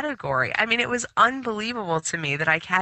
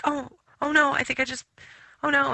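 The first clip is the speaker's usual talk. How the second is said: high-pitched oh no of mild alarm; replaying the moment she realised; retold panic; 8 semitones higher, faster than usual. Vacuumed a bee by accident, says in mock panic. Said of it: "And I was just like".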